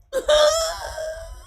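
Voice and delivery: high squealing laugh. Real, not acted. Streamer tickled by a county nickname, squealing laugh. Cracks up at pretty girl county.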